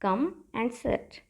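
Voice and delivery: very slow and even; slow drill. Shown as a demonstration. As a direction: lay out each word for the class. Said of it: "कहिए".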